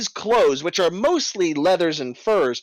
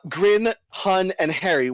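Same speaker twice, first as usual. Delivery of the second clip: usual speaking voice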